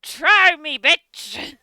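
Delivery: loud taunting dare; mock threat. Player loudly dares friend in mock threat.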